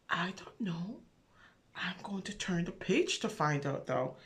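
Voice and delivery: unvoiced conspiratorial whisper. Reader to children, whispering before turning the page. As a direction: whisper the mystery to the little ones.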